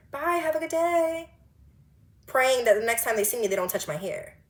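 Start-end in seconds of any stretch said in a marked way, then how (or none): 0.1-1.3 high, slow, forced-sweet goodbye; see them off with strained niceness; fake politeness. Fake politeness to get away.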